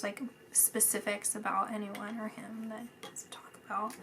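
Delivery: whisper. Real, not acted. Whispering while listening in.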